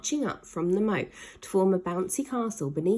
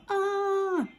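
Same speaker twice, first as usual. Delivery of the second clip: a short yelp, pitched high; high scream; 13 semitones higher than usual. Acted. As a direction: let out a falling cry. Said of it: "he screamed".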